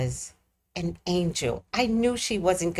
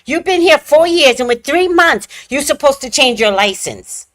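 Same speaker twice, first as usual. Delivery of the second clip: louder, higher scolding; mock yell; 4 semitones higher, 5 dB louder than usual. Officer yells about the license, mock yell. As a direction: scold her like she should know. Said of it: "she yelled at me".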